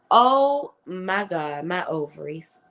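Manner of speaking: very slow, melting "oh my God"; swooning. Retold or imitated. Gushing at the cute little girl, slow and swooning. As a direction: melt over how cute the child is. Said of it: "I was like".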